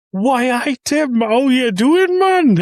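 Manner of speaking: high, loud, slow hammed-up Geordie greeting; bad Geordie accent. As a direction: shout it like a bad Geordie impression. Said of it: "I'm in here going".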